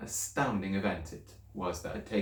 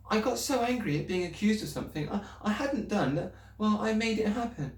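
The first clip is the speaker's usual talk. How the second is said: put-on higher, anxious little girl's voice; girl pleading with her teacher, higher and anxious; young Matilda; 12 semitones higher than usual. A narrator's reading. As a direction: speak up as a worried schoolgirl.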